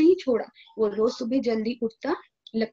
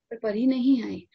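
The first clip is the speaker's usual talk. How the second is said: ordinary delivery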